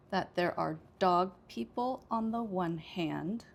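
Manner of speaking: "dog", "people" and "hand" stressed, "on" and "the" light. Shown as a demonstration. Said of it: "So it's dog, people, hand".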